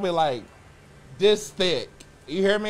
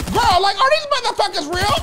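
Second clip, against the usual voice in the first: outraged disbelief; 12 semitones higher, 5 dB louder than usual. Real, not acted. Outraged at game bots.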